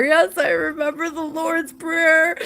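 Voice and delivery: high-pitched tearful retold answer; mock bawling. Penitent teen answering her confessor through tears, mock bawling. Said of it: "I'm through bawling eyes".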